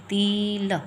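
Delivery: तिल stretched into teel with a long ee; stressed long ee. Shown as a demonstration. Teacher showing a wrong spelling's sound, stressed long ee. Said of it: "हम ज़्यादा stress देंगे ई की पर".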